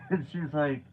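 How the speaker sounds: normal talking voice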